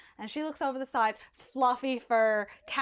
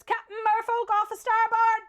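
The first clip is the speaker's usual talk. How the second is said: higher and louder lookout shout; 10 semitones higher, 6 dB louder than usual. Acted. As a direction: yell it up from the crow's nest.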